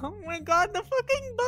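high, thrilled squeal; excited squeal. Real, not acted. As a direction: squeal with delight. Thrilled by the gift bow.

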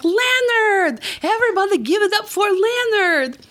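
rally the whole train behind Leonard; excited hype cheer. Acted. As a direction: call it out like a game show host. Cheering on a passenger.

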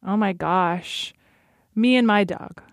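normal talking voice

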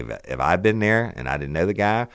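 ordinary talk

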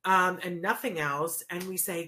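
ordinary talk

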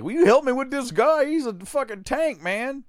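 loud, high, strained call for help; acting out a panicked goon; shouted plea. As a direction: yell it to a buddy mid-fight. Acted plea for help in fight.

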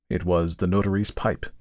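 usual speaking voice

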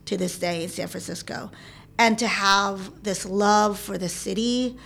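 ordinary delivery

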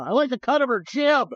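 a touch of hammed-up approval; mock admiration. Imagines Trump admiring Boebert.

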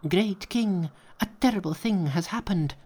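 high, alarmed imp voice; frightened imps. A narrator's reading. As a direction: squeak out the bad news. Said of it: "rushed into the cave and cried".

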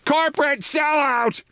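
high, slow mock scream of the kid; a slow, drawn-out hammed-up screech; retold scream. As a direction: shriek it like a bratty kid hurling an insult. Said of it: "and he screams".

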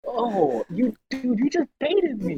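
high, slow laughing disbelief; cracking up at the bait. Real, not acted. Friend tricked into reacting to joke.

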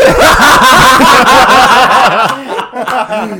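high, loud whooping laugh; loud burst of laughter. Real, not acted.

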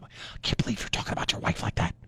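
whispered, prissy imitation of upset friends; mock appalled whisper. Friends shocked by his riffing, mock whisper. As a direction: hush it like a scandalized acquaintance. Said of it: "they get all upset".

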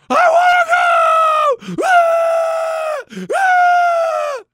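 very high, loud imitated kid shriek; child screaming. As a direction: reenact a five-year-old's screaming fit. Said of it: "he just screams".

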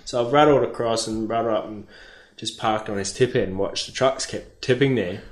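ordinary delivery

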